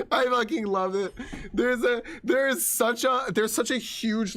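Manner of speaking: real laughter through the words; laughing delight. Real, not acted. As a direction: laugh while raving. Laughing while praising.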